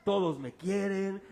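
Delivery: usual speaking voice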